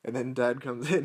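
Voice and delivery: ordinary talk, nothing marked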